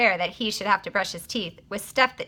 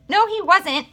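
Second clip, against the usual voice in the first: insisting; 9 semitones higher, 5 dB louder than usual. A narrator's reading. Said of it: "Mary insisted".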